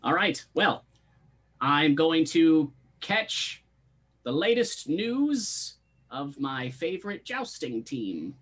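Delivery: high, slow character voice with pauses; Master Stumbleduck. Acted. Boss taking leave of the party, high slow character voice. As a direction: draw it out slowly, pitched well up. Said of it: "Master Stumbleduck wheels down the ramp".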